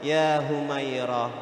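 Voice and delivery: gentle call. Retold or imitated. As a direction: call her name tenderly and slowly. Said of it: "Ketika Rasulullah memanggil istrinya". (panggilan lembut dan pelan)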